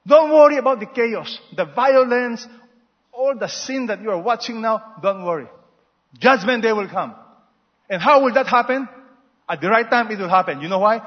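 full-force preaching. Acted. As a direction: thunder it out to the congregation.

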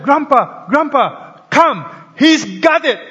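high, loud calling out to grandpa; boy shouting. Retold or imitated. Boy shouting to his grandfather from the barn, excited. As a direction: call Grandpa across the farm, thrilled. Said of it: "And he shouted".

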